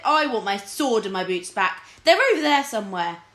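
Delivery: a wounded king ordering his lords back; petulant king. Acted. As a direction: demand it like a sulky monarch.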